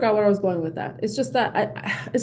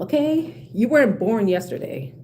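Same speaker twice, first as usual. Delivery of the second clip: ordinary talk, nothing marked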